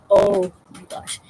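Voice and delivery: a drawn-out groan of disbelief; exasperated oh my gosh. Retold or imitated. Watching husband wake the ferret, slow loud dismayed oh my gosh. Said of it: "I'm just like".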